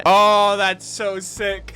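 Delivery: ordinary talk, nothing marked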